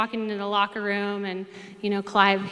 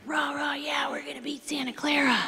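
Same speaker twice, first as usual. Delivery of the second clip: high, half-whispered pep-rally chant; mock team cheer; 6 semitones higher than usual. Freshmen hyping themselves up to beat their rivals, mock cheering. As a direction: imitate a fired-up young team, pitched up without volume.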